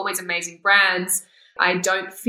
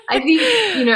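teary laugh; 9 semitones higher, 6 dB louder, much faster than usual. Real, not acted. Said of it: "I'm getting emotional".

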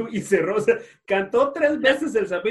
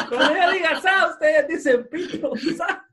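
higher, laughing call to the crowd; mock emcee call; 9 semitones higher than usual. Retelling a concert, mocking the singer's call-and-response, laughing. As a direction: lead the crowd like a hyped emcee.

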